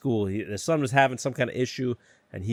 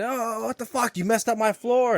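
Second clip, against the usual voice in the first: high mock outrage, what the fuck; lightly hammed indignant yell; angry boss; 11 semitones higher than usual. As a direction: play the furious super catching him. Angry super voiced in story.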